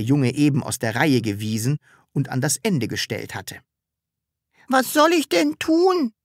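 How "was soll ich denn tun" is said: put-on much higher girl's voice, resigned; a helpless little girl; girl answering Sams with a shrug; shrugging girl. A narrator's reading. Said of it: "fragte das Mädchen achselzuckend".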